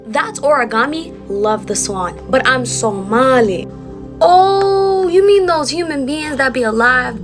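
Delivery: usual speaking voice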